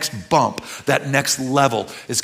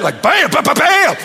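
mock door slams; 11 semitones higher, 7 dB louder than usual. Mimics new car door slams.

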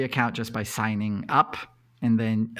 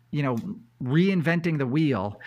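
usual speaking voice

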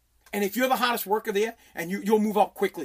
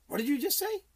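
incredulous; 5 semitones higher, 6 dB quieter than usual. Retold or imitated. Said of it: "And I said".

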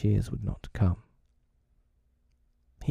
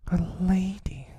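high, slow frightened echo; trembling boy; 11 semitones higher, slower than usual. A narrator's reading. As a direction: say it slowly and high, in mild dread. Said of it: "and fell a trembling".

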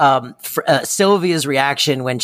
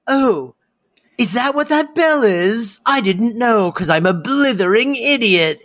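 high mock-dim butler voice, pitched up; doing Niles; 7 semitones higher than usual. Imitating Niles to a co-host, pitched up and clueless. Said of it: "And he's like".

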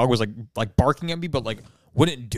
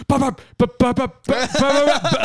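rapid run of high-pitched yaps; imitated dog barking; 12 semitones higher, 4 dB louder than usual. Imitating a poodle being stared down, high yapping. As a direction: yap like a worked-up little poodle.

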